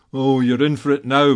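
plain reading voice

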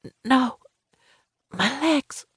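halting, pained reply, pitch rising; effortful answer. A narrator's reading. Injured passenger answering after the crash, with great effort. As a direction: struggle to get each word out. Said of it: "It took all my effort to answer".

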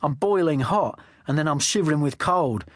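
ordinary delivery

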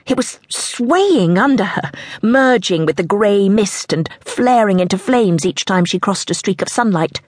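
usual speaking voice

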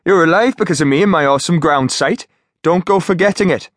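boastful soldier, thick Geordie; Geordie accent. Acted. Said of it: "his thick Geordie accent".

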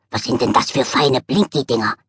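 low dog voice, nosing around curiously; dog voice sniffing curiously at screws. Acted. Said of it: "hörte Lilly Bonsai nun schnuffen".

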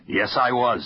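dying father's forceful protest; forceful outburst. Acted. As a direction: fire back with force. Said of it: "He spoke with such force".

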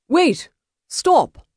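high, loud cry to halt; urgent shout. Acted. Man rushing in to halt a duel, shouting urgently. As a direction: shout to stop it at once.

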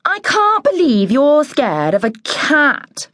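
high, drawn-out mocking child voice; taunting girl. A narrator's reading. Girl taunting her brother over a cat, high and mocking. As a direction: tease slowly in a high voice. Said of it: "a girl's voice taunted".

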